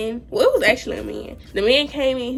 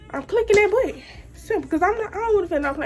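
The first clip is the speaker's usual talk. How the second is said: excited; 8 semitones higher, faster than usual. Real, not acted. Pleased about pain button.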